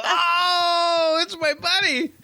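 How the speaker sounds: very high, loud squealed greeting; gleeful squeal. Retold or imitated. Spotting Damien over there, mock gleeful squeal. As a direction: shriek it through laughter, hammed-up delight.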